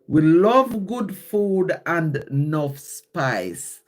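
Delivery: slow, measured Jamaican dialect recital; slow poem reading. Real, not acted. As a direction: read the poem slowly, drawing out the rhymes. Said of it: "I'm gonna read it slow".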